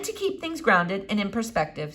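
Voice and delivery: ordinary delivery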